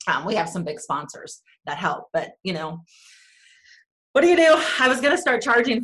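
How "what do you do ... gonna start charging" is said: exasperated outburst. Real, not acted. Exasperated rhetorical question.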